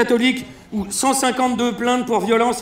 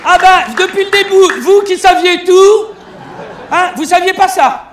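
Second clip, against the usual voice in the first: much higher, louder laughing jab; teasing shout; 8 semitones higher, 9 dB louder than usual. Real, not acted. Comic teasing the audience with a loud laughing jab. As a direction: needle the crowd, half-shouting.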